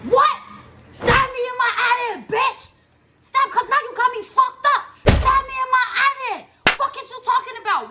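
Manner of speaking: high, loud confrontational yelling; retold furious shout. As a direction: yell her down as you walk at her.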